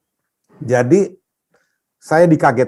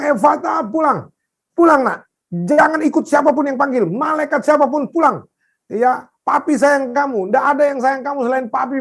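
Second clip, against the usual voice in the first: high, desperate calling back; pleading commands at high pitch; retold shouted prayer; 10 semitones higher than usual. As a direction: re-enact calling a dying child home. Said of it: "teriak-teriak berdoanya".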